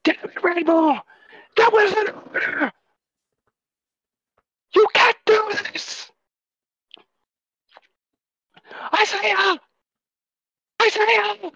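high, loud furious yell; desperate shout. Acted. Captain screaming at the friend who ordered him away. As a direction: scream it in anguish and betrayal. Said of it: "He shouts at you".

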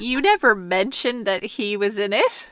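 indignant, swooping "excuse me"; mock outrage. Friend learning Shia LaBeouf was there, mock indignant. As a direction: call out a friend with pretend offence.

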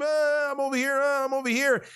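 friend's cry from the dark woods, half-voiced by a storyteller; mock distant scream. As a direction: call out like a lost friend in the woods, lightly. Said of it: "hearing Josh fucking scream".

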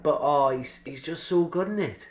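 ordinary talk, nothing marked

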